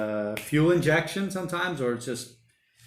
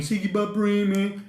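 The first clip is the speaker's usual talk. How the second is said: Siggy Butt Bream said higher in a broad Aussie cartoon accent; Siggy Butt Bream pitched up in a mock broad Aussie accent; 7 semitones higher than usual. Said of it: "in Aussie".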